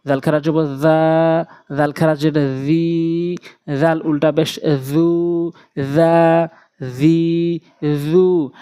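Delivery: long, level-held vowels in জা, জি, জু; one-alif elongation. Shown as a demonstration. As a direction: hold the vowel of each জা, জি, জু for about two beats. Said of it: "এক আলিফ করে টেনে পড়ব".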